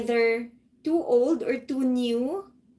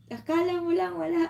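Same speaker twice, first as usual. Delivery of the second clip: delivered movie line; 6 semitones higher, faster than usual. Shown as a demonstration. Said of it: "you deliver it".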